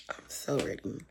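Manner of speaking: ordinary talk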